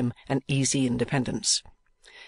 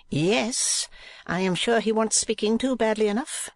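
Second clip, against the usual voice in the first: high, pouting wife's voice; 9 semitones higher than usual. A narrator's reading. Wife complaining to husband about the footman, pouting. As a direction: pout it in a high wifely voice.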